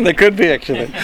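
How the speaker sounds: talking through a real chuckle; amused laughter. Real, not acted. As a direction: laugh along while answering, voice lifted. Joking about leftover Easter eggs.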